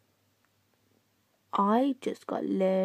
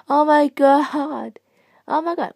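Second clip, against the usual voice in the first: loud, slow, higher gasp of shock; 7 semitones higher, 8 dB louder, slower than usual. Real, not acted. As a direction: stretch it out, stunned and loud. Shocked reaction in game.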